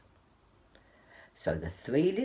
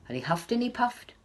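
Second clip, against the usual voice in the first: whispered, puffing huff; breathy huffing; 8 semitones higher, faster than usual. A narrator's reading. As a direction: blow the words out on breath. Pig blowing on the house.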